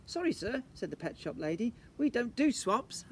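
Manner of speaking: high, prim shop lady; pet shop lady. Acted. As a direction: deliver the punchline primly.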